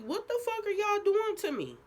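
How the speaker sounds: high, loud panicked yell. Retold or imitated. Retold panicked outburst at nurses.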